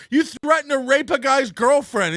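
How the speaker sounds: usual speaking voice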